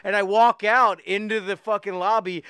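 ordinary talk

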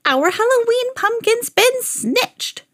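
put-on slow, very high old woman wail; high, drawn-out ancient voice; seven-hundred-year-old woman's cry. A narrator's reading. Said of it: "she cried in a seven hundred-year-old voice".

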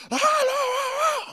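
high, drawn-out muffled wail; loud, slow wordless howl in a child's pitch; imitated child's wail. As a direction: mimic a little girl wailing from downstairs. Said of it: "hear Raina from downstairs going".

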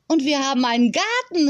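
calling out. A narrator's reading. Girl at the door sees the garden, calling out. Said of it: "rief Leni".

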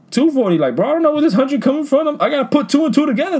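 pitched high, words rushing out; venting in disbelief; exasperated rant. Real, not acted. Worker venting about a yelling customer, high exasperated rush.

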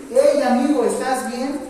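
loud, energetic check on a patient; energetic call. Shown as a demonstration. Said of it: "Bonito, llega bien".

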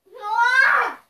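high loud call; yelling a name. Real, not acted. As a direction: shout across to someone. Yelling for friend.

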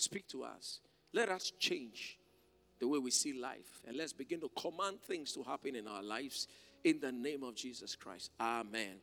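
prayer. Real, not acted. Closing prayer.

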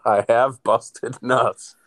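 very slow, drawn-out mocking repeat; mock echo. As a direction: stretch each word to tease him. Echoing friend's line mockingly.

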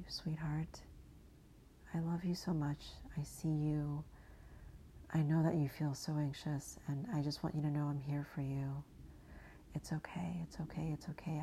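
soft, tender whispered reassurance; soothing whisper. Pretend. To her anxious inner child, a soft comforting whisper. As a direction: hush her gently, like calming a frightened little girl.

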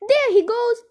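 high, loud cry of discovery; excited onlooker's call; shouting. A narrator's reading. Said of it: "somebody shouted".

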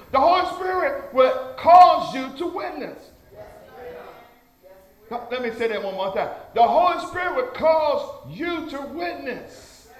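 high, forceful sermon delivery; ringing pulpit declaration; fervent preaching. Real, not acted. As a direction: proclaim it to the congregation, leaning on key words. Preaches at raised pitch and force.

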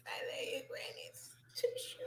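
soft breathy whisper. Real, not acted. Whispering love to her grandmother, hushed. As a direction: breathe the words out softly. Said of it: "I need to stop talking like that".

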